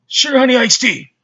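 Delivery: high, loud mock yell of a fake cuss; silly yell. Kid yelling a fake cuss at a bully, high mock shout. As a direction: shout the nonsense phrase back, high and loud. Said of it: "you just yelled that in their face".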